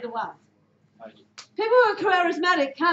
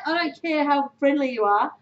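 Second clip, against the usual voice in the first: ordinary delivery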